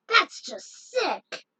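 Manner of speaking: slow, high cry of disgust; disgusted outburst. Acted. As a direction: drag it out, repulsed. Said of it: "recalled Team P D four".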